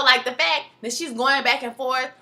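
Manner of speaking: ordinary delivery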